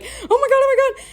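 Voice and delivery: high panicky mock gasp of alarm; imitated freaking out. Sister on the phone to a friend after the fight, high panicked imitation. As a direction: squeal it high like a frightened teen. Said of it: "called one of our friends and was like".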